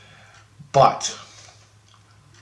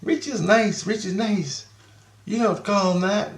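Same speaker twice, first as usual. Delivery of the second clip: put-on high, childlike sing-song; kids' voices; 9 semitones higher than usual. His kids defending him to the girls, childlike high voice. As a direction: pitch it up like a little kid defending dad. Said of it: "My kids are going".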